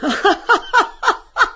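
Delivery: very high, loud hooting guffaw. Acted. Bello guffawing at Bloom, loud hooting laugh. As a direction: let out a huge mocking guffaw. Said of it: "Bello guffaws".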